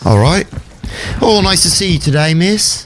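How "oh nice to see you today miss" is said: higher, polite put-on English accent. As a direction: greet a lady in a genteel English accent. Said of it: "Keep going".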